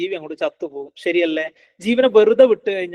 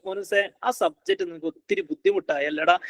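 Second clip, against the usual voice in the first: high-pitched coddling concern; tender demo of empathy, voice pitched well up; sympathetic parent; 9 semitones higher, 4 dB quieter than usual. Shown as a demonstration. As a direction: coo sympathy to a struggling child. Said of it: "ഈ രൂപത്തിൽ നമ്മുടെ communication style മാറ്റാം".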